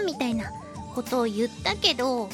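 ordinary talk, nothing marked

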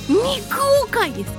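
ordinary talk, nothing marked

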